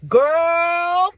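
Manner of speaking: emphatic cautioning call; drawn-out warning. Real, not acted. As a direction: stretch it out as a plea to listen. Warning other women directly.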